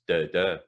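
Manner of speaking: both da hit evenly; double stress. Shown as a demonstration. Tutor chanting Dundee with two stresses. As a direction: give both beats equal weight. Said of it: "double stresses".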